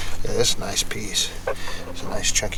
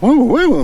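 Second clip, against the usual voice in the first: high whooping whistle imitation; whistling noise; 8 dB louder than usual. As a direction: squeal it like a detector whistle. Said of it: "whistling, all kinds of noises".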